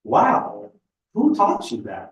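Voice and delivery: a real burst of retold surprise; retold amazement. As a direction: react like she just surprised you. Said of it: "I thought".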